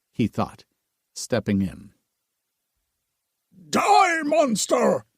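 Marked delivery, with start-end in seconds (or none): 3.7-5.0 shrieked battle cry, high and loud; panicked shrieking attack. Acted. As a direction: scream it as you lunge with the knife. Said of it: "a voice shrieked".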